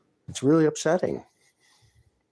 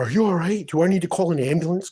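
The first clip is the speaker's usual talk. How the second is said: higher, louder fake-caring voice; mock concern; 7 semitones higher, 5 dB louder than usual. Imitating the neighbour offering an ambulance, mock concern. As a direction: put on a sugary worried tone. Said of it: "And she come over".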